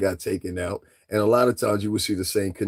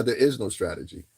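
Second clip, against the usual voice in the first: normal talking voice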